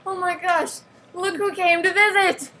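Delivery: high, loud squeal of welcome; thrilled, playful exclamation; excited surprise. Pretend. Greets a surprise visitor in story.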